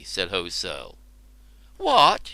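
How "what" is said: high shocked cry; cried out. Acted. Woman shocked by the warrior's reply, a high cry. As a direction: jolt out in disbelief. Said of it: "she cried".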